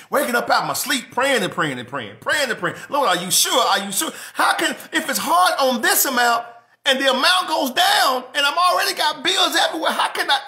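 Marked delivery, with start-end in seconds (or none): 2.9-10.4 high, loud, half-laughing plea to God; frantic pleading prayer. Retold or imitated. Pastor replaying his prayer about the bills, frantic and laughing. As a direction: shout the worry up to heaven, laughing at yourself.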